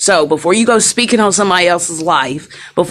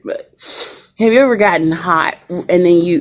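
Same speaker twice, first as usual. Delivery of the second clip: ordinary delivery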